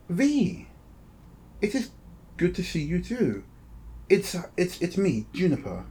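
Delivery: pleasantly surprised robot. Acted. Game master voices robot Juniper.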